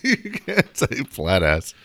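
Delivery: laughing. Real, not acted. Cracks up at cohost's insult.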